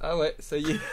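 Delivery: high, loud laughter; laughing. Real, not acted. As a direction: laugh through the words. Laughing at the chair.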